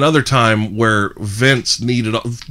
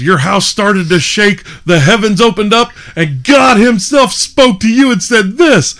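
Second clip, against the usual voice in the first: wrestler's promo; 11 semitones higher, 6 dB louder than usual. Retold or imitated. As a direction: build grandly toward God's answer. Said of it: "or he goes".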